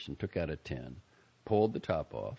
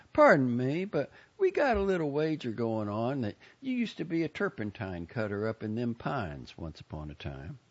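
raised Southern voice accosting a stranger; folksy inquisitive lady; 6 semitones higher, 3 dB louder than usual. Acted. Lady to bus driver about her wager, forward. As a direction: call for attention, then ask nosily.

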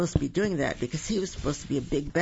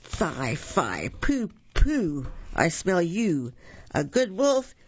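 theatrical giant with big pitch swings; storybook giant; 4 semitones higher, slower than usual. A narrator's reading. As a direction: boom out the giant's chant, rising in pitch. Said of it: "said the giant".

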